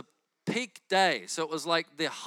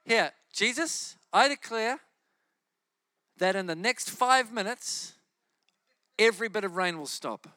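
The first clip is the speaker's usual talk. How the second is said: loud faith-filled proclamation; bold prayer; 4 semitones higher, 5 dB louder than usual. Retold or imitated. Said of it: "I said".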